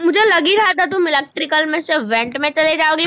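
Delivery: ordinary talk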